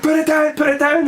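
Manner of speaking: high-pitched frantic retold plea; mock panic. Sensei to child holding a live spider, high-pitched panic. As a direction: plead in rising hammed-up alarm.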